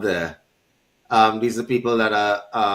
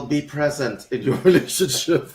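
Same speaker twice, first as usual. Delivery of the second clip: cracking up while saying it; can't hold back the laugh; laughing mid-phrase; 3 semitones higher than usual. Real, not acted. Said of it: "give me a second... keep a straight face".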